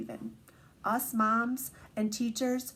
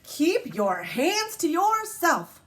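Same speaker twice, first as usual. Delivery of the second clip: strict teacher; 6 semitones higher, 4 dB louder, slower than usual. Acted. Teacher's rule.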